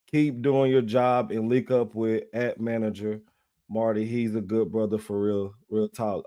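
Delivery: low, quiet read-out of a comment; reading aloud. Real, not acted. Host reads a viewer's comment aloud, low and quiet. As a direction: read it low and softly, almost to yourself.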